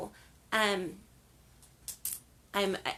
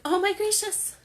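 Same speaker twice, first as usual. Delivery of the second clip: high, loud gasp of surprise; shocked exclamation; 12 semitones higher, 8 dB louder than usual. Retold or imitated. Driver hearing her car leaks gasoline, shocked high exclamation. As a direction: blurt it out in sudden alarm. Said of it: "I'm like".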